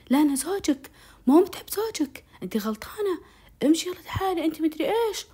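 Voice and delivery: high-pitched, insistent arguing; retold agitated quarrelling. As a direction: imitate an agitated man insisting. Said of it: "وكان يتنافض ويهاوش".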